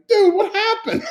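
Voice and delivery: high, laughing mock-shocked "dude, what happened"; imitating a stunned friend. A fellow chef asks him what went wrong, in a high laughing mock-shocked voice. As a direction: squeak it out, half laughing. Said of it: "And Gregory was like".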